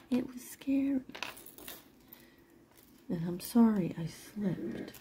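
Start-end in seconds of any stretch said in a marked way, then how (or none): 0.1-1.0 hushed, whispery "scary"; soft confiding whisper; whispered repeat. Real, not acted.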